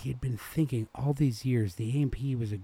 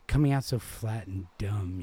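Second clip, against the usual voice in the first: plain reading voice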